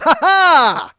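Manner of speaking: loud high mock maniac laugh; crazy laugh. Comic laughing in the yelling man's face, loud and high. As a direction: laugh in his face to unsettle him. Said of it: "And I went".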